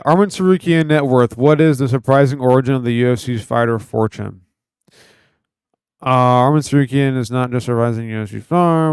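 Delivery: loud article read-out; reading aloud. Acted.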